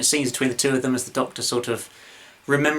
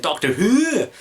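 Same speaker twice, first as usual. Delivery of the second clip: "Doctor Who" in a bad high Scottish voice, "Who" falling; mock Missy saying "Doctor Who" Scottish, pitched high; terrible Scottish impression; 8 semitones higher, 3 dB louder, slower than usual. Fan imitates Missy saying "Doctor Who", badly faked Scottish accent. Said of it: "that was a terrible re-, um, impression there".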